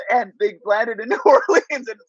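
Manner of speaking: laughs through the punchline; chuckling at his own story; amused, laughing as he tells it; laughing while telling. Real, not acted. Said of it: "It was so funny".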